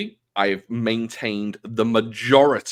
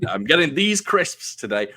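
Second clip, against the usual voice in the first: proud declaration, raised pitch; mock loud announcement; 6 semitones higher, 4 dB louder than usual. Husband proudly telling his wife about crisps, mock announcement. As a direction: announce it a little too proudly. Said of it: "I loudly announced that I bought crisps".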